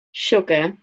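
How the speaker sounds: usual speaking voice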